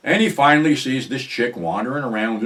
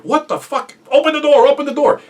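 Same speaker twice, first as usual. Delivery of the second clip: high, loud, frantic retold yell; panicked shouting; 9 dB louder than usual. Man chased by a dog at the car, panicked shouting. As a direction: yell urgently to be let in.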